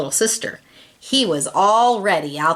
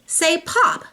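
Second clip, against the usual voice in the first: playful pop; 11 semitones higher than usual. A narrator's reading. Teacher demonstrating sound words.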